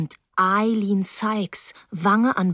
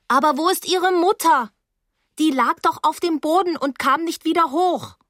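high, alarmed protest; distressed girl demanding an answer; agitated insistence; 8 semitones higher than usual. Acted.

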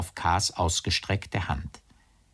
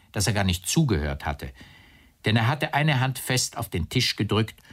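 plain reading voice

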